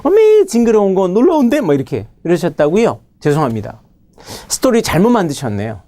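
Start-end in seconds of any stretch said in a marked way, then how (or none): 0.0-1.6 high cry on 어메이징, then easing; squealed pretend shock; mock astonishment. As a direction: re-enact a hammed-up startled reaction. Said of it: "자, 아까".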